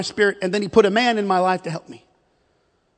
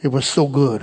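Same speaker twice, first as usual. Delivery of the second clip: mock diner reply; 8 semitones lower than usual. Shown as a demonstration. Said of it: "This is how you talk to him like that".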